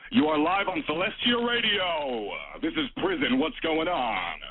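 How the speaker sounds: high, slow put-on radio voice; radio announcer voice. Caller opens his show in a hammed-up radio voice. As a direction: stretch it out like a cheesy DJ. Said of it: "for a radio voice".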